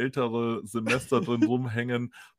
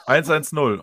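louder, eager repeating of eins eins null; 3 semitones higher, 8 dB louder, slower than usual. Retold or imitated. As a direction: bark back eins eins null, pleased. Drunk repeats number to operator.

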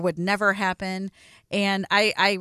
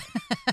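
very high, soft giggle; brief high laugh breaking into the talk; 5 dB quieter than usual. Real, not acted. Woman amused mid-example of excusing others, soft high giggle.

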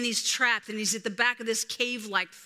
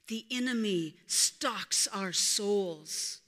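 ordinary talk, nothing marked

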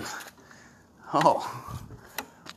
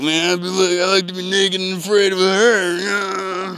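drawn-out, slightly higher mock voice; mocking imitation of friend; 3 semitones higher, 5 dB louder than usual. Teasing friend as a pervert, drawn-out mock voice. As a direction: draw it out in a teasing put-on voice. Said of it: "You're like".

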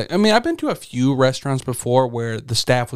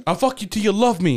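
lightly imitated high-pitched boxer threat; Mike Tyson; 8 semitones higher than usual. Co-host quoting a boxer's threat to a heckler, lightly imitated. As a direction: pitch it up, mock menace. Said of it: "like Mike Tyson when he was yelling".

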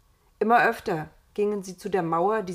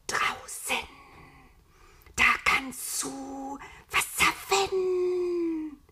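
slow, high serpent temptation; hissing whisper; 8 semitones higher, much slower than usual. A narrator's reading. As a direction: lure them with long drawn-out hisses. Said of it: "zischte und wisperte ganz ohne Stimme".

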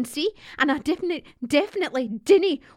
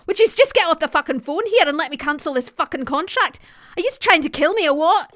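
louder, rising angry rant; furious shouting; 5 semitones higher, 7 dB louder than usual. Acted. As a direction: lose it at the phone line. Furious caller shouting at phone.

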